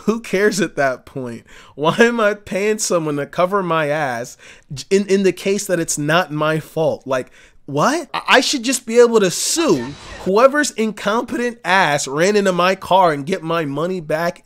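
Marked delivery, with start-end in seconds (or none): none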